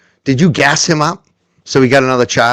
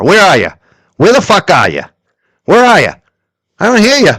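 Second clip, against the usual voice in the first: louder, rising jeering calls; taunting call-out; 7 semitones higher, 5 dB louder than usual. Real, not acted. Host taunting Pandora to answer him, loud and jeering. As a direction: goad her to answer, calling across the room.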